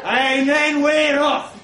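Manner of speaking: loud, high, drawn-out announcement; excited bellow. A narrator's reading. Narrator launching into the fight with Trev, bellowing. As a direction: roar it like the start of a race.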